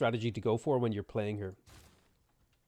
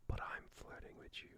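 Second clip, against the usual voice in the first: whisper; 18 dB quieter than usual. Pretend. Teasing flirt reply to chat.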